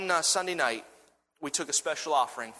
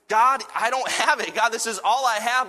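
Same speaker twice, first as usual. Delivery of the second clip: loud, high, urgent plea; pleading protest; 8 semitones higher, 7 dB louder than usual. Retold or imitated. Man telling God it is all he has, pleading. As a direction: cry out to God in earnest protest.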